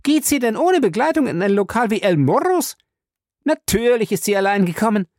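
hohe, keifende Frauenstimme; erneut wütend. A narrator's reading. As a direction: bissig und zornig. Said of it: "kam aufs Neue in Wut". (Fay kommt wieder in Wut, hoch)

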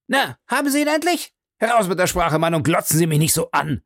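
angry baronet demanding his shoe; impatient demand. A narrator's reading. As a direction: snap at the servant to answer. Angry guest confronts hotel servant.

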